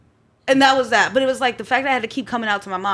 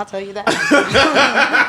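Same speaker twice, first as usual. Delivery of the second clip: laughing; 5 dB louder, much slower than usual. Real, not acted. Laughing at prison remark.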